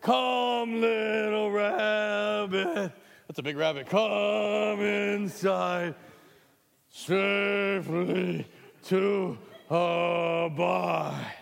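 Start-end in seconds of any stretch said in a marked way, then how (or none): 0.0-2.9 loud, slow, blown-up line; big booming voice. Shown as a demonstration. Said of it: "You lower your voice". 3.9-11.4 loud, slow, blown-up singing; big booming voice. Shown as a demonstration. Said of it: "You lower your voice".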